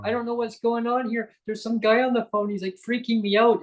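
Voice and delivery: put-on high-pitched freaked-out young guy; screaming son. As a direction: complain to Dad in a high, panicked voice. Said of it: "He's screaming".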